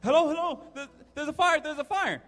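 high, urgent mock panic; stammering, high-pitched pretend alarm; pretend emergency call. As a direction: play a kid frantically reporting a fire. Boy phones in pretend fire.

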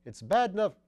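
high, very loud exasperated outburst; harassed. A narrator's reading. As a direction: burst out, at the end of patience. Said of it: "said the harassed Sugg".

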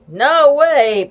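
loud refusal; cried out. A narrator's reading. Friends shouting back at the little sister, loud. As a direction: call it out firmly. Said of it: "cried out together".

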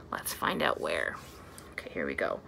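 hushed secret whisper. Acted. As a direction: lean in and whisper to the kids. Whispered to kids.

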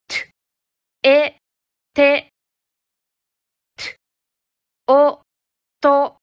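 bare "t" burst, then each vowel, then both joined, as in と、あ、た; consonant-vowel blending. Shown as a demonstration. Teacher joining t to five vowels, sounded out piece by piece. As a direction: build each syllable from と、あ、た onward in separate, crisp steps. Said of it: "五つの母音をつなげて発音してみましょう".

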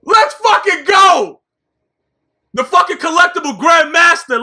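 loud slow victory yell; frat bro. Real, not acted. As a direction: roar it out in triumph. Celebrating card stats.

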